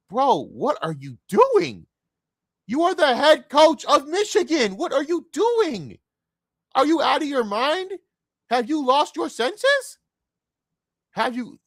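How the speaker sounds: high, drawn-out shout of disbelief; scolding an absent coach, high-pitched and loud; incredulous yelling. Acted. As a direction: yell it slowly, stretching each word in outrage. Acted dressing-down of the head coach.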